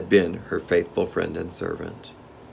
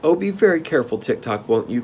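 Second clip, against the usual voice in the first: high start, pleading concern; anxious cry; 6 semitones higher than usual. A narrator's reading. Girl warns the machine man, anxious. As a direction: beg him with mild alarm. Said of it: "cried the girl".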